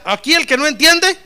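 high, very loud call for the room to echo; loud repeat-after-me. Real, not acted. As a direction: lead the crowd to shout it back. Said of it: "A ver, diga".

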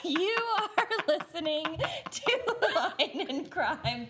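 giggling through the intro; can't stop laughing mid-sentence. Real, not acted.

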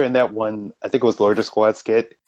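ordinary talk

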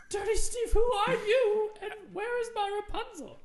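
high, loud indignant shriek of disbelief; shocked outrage. Acted. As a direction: cry out in outraged surprise. Witch shocked at squatter.

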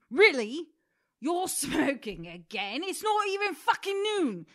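loud, slow, berating chef rant; Gordon Ramsay yelling. Pretend. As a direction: tear into them like an angry TV chef. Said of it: "What do you think are some of the things he would say".

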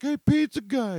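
very high, loud tipsy holler; tipsy woman hollering at the pizza guy; drunk calling out. Retold or imitated. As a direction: yell it across the yard at the delivery man.

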